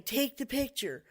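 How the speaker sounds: pressing storyteller's command; urgent command. Retold or imitated. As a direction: imitate an insistent voice from behind. Voice behind frightened boy gives order.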